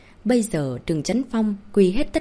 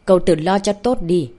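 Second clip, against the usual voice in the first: louder, coldly menacing warning; cold threat; 6 dB louder than usual. A narrator's reading. Tống Nghi Nhiên leaves Ngô Bách Tuế with a warning, coldly threatening. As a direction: deliver it as a cold, forceful threat. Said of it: "hừ một tiếng rồi lạnh lùng dọa".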